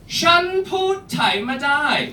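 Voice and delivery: "Shan put Thai ma dai" said high and slow, syllable by syllable; Thai phrase. Shown as a demonstration. Comedian teaching the audience the Thai phrase, slowly. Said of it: "the way you say this phrase ... goes like this".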